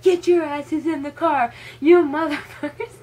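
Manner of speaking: hammed-up angry father yelling at the kids; imitating a yelling dad. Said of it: "and Dad screams".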